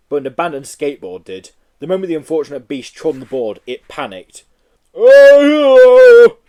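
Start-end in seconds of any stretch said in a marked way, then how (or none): 4.9-6.4 very loud high yell; Tarzan scream. Acted. Yeti panicking on skateboard, very loud scream. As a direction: howl like Tarzan on fire. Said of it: "it screamed".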